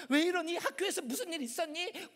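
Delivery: high-pitched anxious mom questions; fretting mother. Acted. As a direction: call through the door as a worried mom. Voices worried mother outside door.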